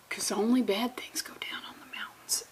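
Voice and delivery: whispered ominous aside; hushed horror-movie whisper; spooky whisper. Acted. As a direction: breathe it like a campfire tale.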